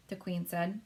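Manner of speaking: usual speaking voice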